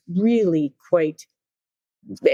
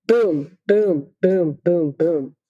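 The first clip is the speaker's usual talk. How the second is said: marching beats; much slower than usual. Retold or imitated. Marching sound effect.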